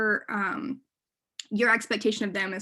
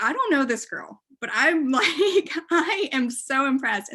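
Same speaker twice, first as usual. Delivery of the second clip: gushing; 5 semitones higher, 5 dB louder, slower than usual. Retold or imitated. Retelling her own gushing at talk.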